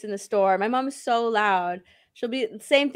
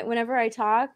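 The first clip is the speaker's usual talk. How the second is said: ordinary talk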